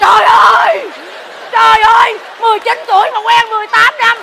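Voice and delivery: high, loud cries of disbelief; shocked outcry. Real, not acted. Hearing the nineteen-year-old lover story, high shocked outcry. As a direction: shout your disbelief. Reacting to scandalous news.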